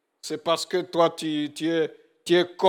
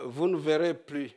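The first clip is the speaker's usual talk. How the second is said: usual speaking voice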